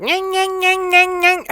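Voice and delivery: very high nasal-free nyang nyang mockery; whiny nagging nyang. Mocking a nagging woman, very high whiny nyang. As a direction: squeal it like endless nagging. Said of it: "listened to one woman like".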